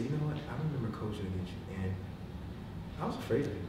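soft, low-key coach's confession; Tony Dungy. Retold or imitated. Quiet coach admitting fear to a former opponent, softly. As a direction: imitate a quiet leader speaking gently. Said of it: "that's how he talked".

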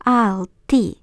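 "Alltid" said as "alti", final d dropped; silent final d. Shown as a demonstration. Teacher models "Alltid" without the final d. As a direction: say "Alltid" with no d at the end. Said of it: "ми не вимовляємо останню літеру Д".

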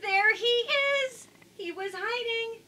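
high, slow, delighted there he is; excited reveal. Real, not acted. Reader showing children the hidden fish, high and excited.

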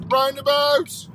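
high, loud sung-out call; roundabout shout. Pretend. Dedicated to Sam from Canada, high loud shout. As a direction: belt it out high on one held note.

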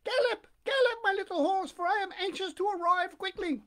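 very high, loud, excited puppet cry; joyful Pinocchio. A narrator's reading. Puppet astride the pigeon's back, joyful high exclamation. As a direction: urge the horse on, high and gleeful. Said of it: "he exclaimed joyfully".

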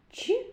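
a light, high, palatalised Ч; soft ch sound. Shown as a demonstration. Teacher showing the class the soft Ч in Очі. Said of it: "м'який приголосний".

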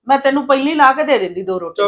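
emphatic assurance. Acted. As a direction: protest that you'd have fed him first. Sister-in-law shows she cares.

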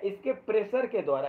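ordinary talk

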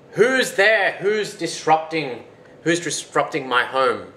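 high, loud giant demanding who's there; indignant challenge. Acted. Blind giant confronts whoever disrupts his home, indignant challenge. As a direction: boom it out, dragging the words.

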